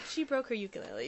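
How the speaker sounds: usual speaking voice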